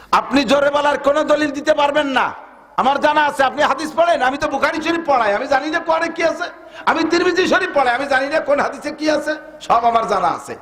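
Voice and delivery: high, fervent sermon voice; preaching at full force. Acted. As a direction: preach it out with rising fire. (উচ্চস্বরে জোরালো ওয়াজ)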